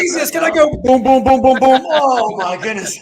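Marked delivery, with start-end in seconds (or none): 0.0-1.8 mock drum roll. Mock drum roll after joke.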